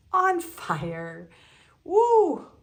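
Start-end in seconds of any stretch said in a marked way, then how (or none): 1.8-2.5 very high, loud woo; squealing cheer of delight; loud excited whoop. Real, not acted. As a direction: shout out a thrilled whoop. Reacting to "on fire" card.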